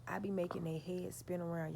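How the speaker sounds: ordinary delivery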